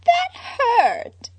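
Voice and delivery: high, pained cry of Harley; whimpering. A narrator's reading. Harley thrown off the stage, whimpering in pain. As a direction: wail it like a hurt child. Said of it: "she whimpered".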